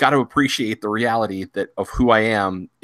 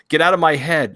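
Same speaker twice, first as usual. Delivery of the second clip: higher, louder retold outburst; mock protest; 7 semitones higher, 5 dB louder than usual. Wife objecting to husband reading her thoughts, loud mock protest. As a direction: burst out in exasperated mock objection.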